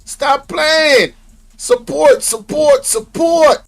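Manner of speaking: loud high hype call to viewers; hype shout. Real, not acted. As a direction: pump up the live chat. Hyped live-stream plea.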